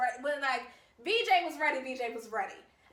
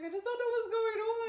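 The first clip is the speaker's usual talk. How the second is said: much higher, wailing like about to cry; hammed-up tearful whine; mock crying wail; 10 semitones higher, faster than usual. Telling how she ran to her room crying, mock wailing. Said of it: "ran into my room and started crying".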